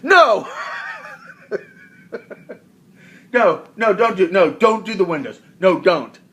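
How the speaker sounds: high, loud laughing shouts of no; mock panic pleading; frantic no. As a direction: shriek no no at him, half laughing. Pleading with husband over windows.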